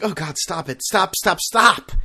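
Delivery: from muttered to a loud high "stop"; exasperated stop, stop, stop rising to a shout; building plea to stop. Retold or imitated. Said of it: "I was just like".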